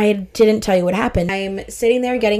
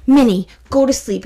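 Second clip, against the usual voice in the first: put-on higher, louder little-kid command; bossy toddler; 4 semitones higher, 5 dB louder than usual. Toddler to her Minnie Mouse, bossy command. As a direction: imitate a small child ordering a doll about.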